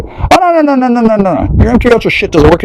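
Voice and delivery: high squealing string of no's, faked alarm; mock horror. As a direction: squeal it out like fake panic. Mock dismay at MK Ultra.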